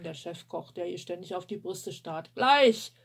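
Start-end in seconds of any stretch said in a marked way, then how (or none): 2.3-2.9 loud, higher yell back; shouting back. Acted. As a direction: yell it back across the room. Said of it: "brüllt Tammy zurück".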